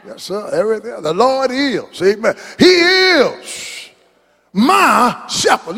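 rising, drawn-out preacher's cry; preaching at full force. Acted. Preacher crying out the psalm to the church, full force.

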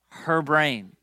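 ordinary talk

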